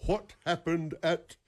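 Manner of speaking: slow Southern televangelist cadence; slow, deliberate Southern preacher voice; Jimmy Swaggart. Acted. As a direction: draw it out slowly, word by word. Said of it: "Jimmy Swaggart always sounded like".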